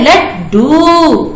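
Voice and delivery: लड्डू very slow with the half ड held; slow demo. Shown as a demonstration. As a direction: draw out लड्डू. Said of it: "आधा है". (लड्डू खींचकर)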